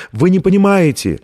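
high-pitched urgent protest; crying out. A narrator's reading. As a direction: call it out desperately, pitch lifted. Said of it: "закричала она вглубь колодца".

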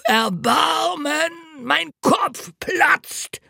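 high, slow anguished wail; hungover cry. A narrator's reading. Hungover road mender crying out about his head, high slow wail. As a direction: wail for mercy, clutching the head. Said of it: "schrie er".

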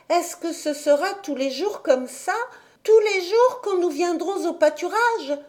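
put-on a curious little girl asking; little girl Heidi. A narrator's reading. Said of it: "demanda Heidi".

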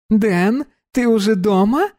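put-on bright high young woman; high girl voice.

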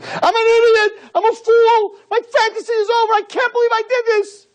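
he sees the film of himself and screams; screaming in despair. Acted. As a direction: scream it like his dream just shattered. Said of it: "and screaming".

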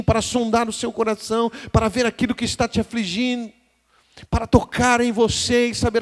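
high, loud preaching that builds and holds; full-force preaching. Acted. Pastor preaching to the congregation, full-force sermon voice. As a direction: preach it out to the whole church, full voice.

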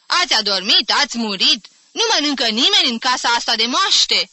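loud, high indignant questions; shouted complaint. A narrator's reading. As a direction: holler at a sleeping household. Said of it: "strigă acesta".

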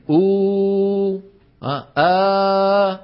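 أو and آه held very long from the vocal cords; sustained vowels. Shown as a demonstration. Teacher showing how vowels start from the vocal cords. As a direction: hold each vowel long and steady. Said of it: "منشأ الصوت من الوترين".

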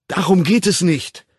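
loud, high retort; frustrated outburst; exasperated. A narrator's reading. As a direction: cut her off in frustration. Exasperated interruption.

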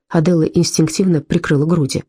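ordinary delivery

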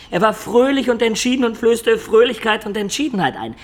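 lauter und höher, voller Begeisterung; schwärm von ihm, als stünde er vor dir; begeistert. Acted. Rapturous praise of Friedrich. (Baudolino schwärmt Niketas von Friedrich vor, begeistert)

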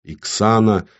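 ordinary delivery

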